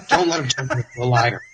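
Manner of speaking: mock-wounded grandmother, hurried plea; stricken grandma. Grandma praying on hearing the news, mock-stricken plea. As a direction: plead as if just stabbed. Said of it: "sounded like she had been stabbed".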